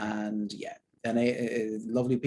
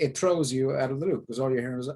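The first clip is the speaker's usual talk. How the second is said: normal talking voice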